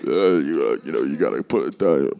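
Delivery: low, mumbled boxer voice; Sylvester Stallone. Acted. Showing co-host how Stallone talks, low halting impression. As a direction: do a low, halting movie tough guy. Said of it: "Sylvester Stallone is like".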